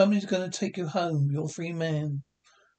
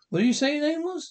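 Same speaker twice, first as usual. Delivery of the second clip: eager high question; 10 semitones higher, faster than usual. Acted. Excited question.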